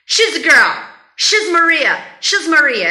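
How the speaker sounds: loud "She's" with a short vowel, linked to the next word; natural contraction. Shown as a demonstration. Teacher drilling what the American will say, contracted. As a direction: punch out "She's" as one short syllable. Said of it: "o cara vai falar".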